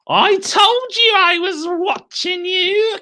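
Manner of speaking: high, slow cackling crone voice; cackling witch. Acted. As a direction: gloat like a spiteful old hag. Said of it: "cackled Missus Twit".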